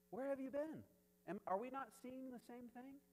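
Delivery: high-pitched mock bafflement; incredulous Moses. Moses to the doubting people, incredulous. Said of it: "Moses is like".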